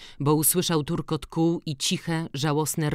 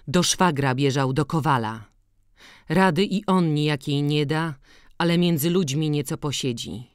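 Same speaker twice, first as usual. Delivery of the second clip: plain reading voice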